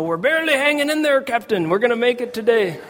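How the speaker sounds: high-pitched, strained engineer's report; Scotty from Star Trek. Acted. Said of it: "have Scotty from Star Trek".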